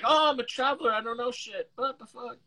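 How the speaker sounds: high-pitched put-on traveler voice; clueless traveler. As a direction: play the helpless tourist, high and light. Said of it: "'cause it's like".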